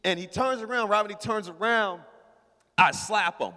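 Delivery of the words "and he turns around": ordinary talk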